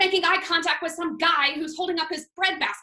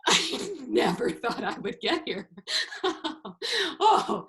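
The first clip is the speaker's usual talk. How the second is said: slow words breaking into laughter; overcome, laughing relief; slower than usual. Acted. Server overcome at reaching the table, laughing relief. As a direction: laugh with disbelief at making it.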